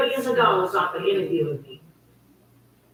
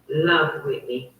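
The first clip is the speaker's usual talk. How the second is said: usual speaking voice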